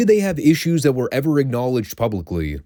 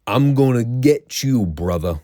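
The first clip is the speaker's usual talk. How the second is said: put-on slow, drawn-out Gary Hart accent; hammed-up attempt at Gary Hart's accent, trailing down on the last word; 3 semitones higher, slower than usual. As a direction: do a bad Gary Hart accent, stretching the threat. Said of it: "the worst possible Gary Hart accent".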